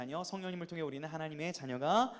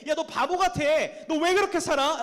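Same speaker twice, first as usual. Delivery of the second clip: loud, high scornful outburst; jeering; 8 semitones higher, 8 dB louder than usual. Retold or imitated. Preacher voicing classmates mocking a believer, jeering. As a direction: taunt them, pitched way up and loud.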